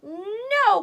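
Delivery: high, loud squealed no; crying out. Acted. Little pig refuses the wolf, loud and high. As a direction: squeal it in defiance. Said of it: "cried the little pig".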